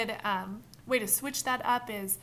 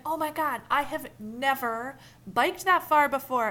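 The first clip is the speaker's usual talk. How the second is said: put-on loud, slow, thrilled teen boast; excited youth; 5 semitones higher, 9 dB louder than usual. Shown as a demonstration. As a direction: burst out with pride. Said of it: "If they were like".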